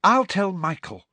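high, impatient offer; impatiently. A narrator's reading. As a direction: cut in as if fed up. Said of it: "said Ginny impatiently".

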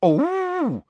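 very high, swooping mockery; high-pitched gargoyle. A narrator's reading. Second gargoyle mocking pupil's urgency, high-pitched. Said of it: "in a high-pitched voice".

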